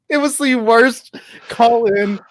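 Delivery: laughing. Real, not acted. Laughing at own bad prank call.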